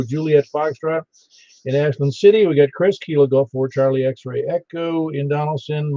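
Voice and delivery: usual speaking voice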